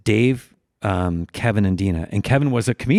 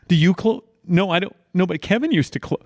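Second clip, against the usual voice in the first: normal talking voice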